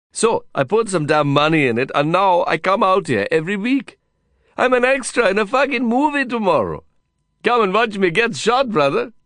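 put-on high, bragging Indian-accented voice; exuberant Indian cowboy. Cowboy friend inviting Lin to the shoot, excited and high. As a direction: brag excitedly, pitched high.

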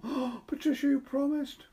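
put-on a hurt girl pleading through tears; tearful plea. A narrator's reading. Edith tearful, reproaching Patricia.

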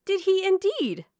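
put-on high, loud, thrilled young woman; excited exclamation pitched right up high; delighted. A narrator's reading. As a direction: cry out with eager delight. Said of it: "cried Marianne with sparkling eyes".

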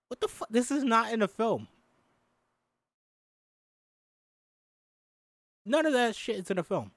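high, incredulous disbelief; surprised outrage. Real, not acted. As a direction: sputter at what you just read. Reader finds text not in film.

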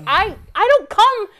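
high, loud exasperated complaint, laughing at end; indignant outburst. Retold or imitated. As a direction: whine it out like a fed-up customer. Her own complaint to the cashier, acted out.

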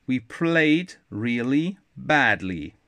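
slow, downcast delivery; sadly. Acted. Ananda admitting her team played badly, slow and downcast. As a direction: let the line sag with disappointment. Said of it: "said Ananda sadly".

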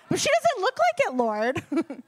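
high, loud mock-whining to God; squeaky self-mocking objection; whiny protest. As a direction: play the doubting child. Acting her own protest to God.

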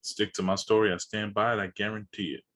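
low, quiet deadpan boast. Pretend. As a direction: say it like an ad pitch. Jokey deadpan manifesto.

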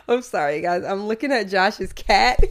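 talking through suppressed laughter; fighting back a giggle while apologizing; stifled giggles. Real, not acted. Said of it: "trying not to laugh".

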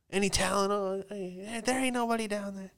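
high, airy calling-out voice looking down at the pool; lightly mocking rich guy calling down from a balcony; eighties stockbroker. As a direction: play a smug eighties stockbroker scanning the pool. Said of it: "a stockbroker would say in the eighties".

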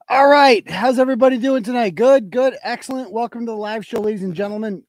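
loud, high show opener; show host welcome. Acted. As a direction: greet the audience like a showman.

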